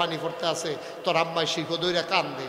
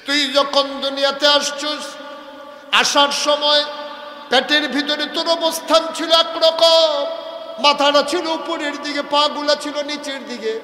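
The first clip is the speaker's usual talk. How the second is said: higher and louder preaching; impassioned preaching; 8 semitones higher, 7 dB louder than usual. Acted. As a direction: preach it out to the crowd. Preacher raises to full force.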